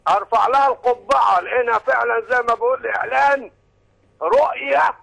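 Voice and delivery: loud, high caller shouting praise; shouting over a phone line. Real, not acted.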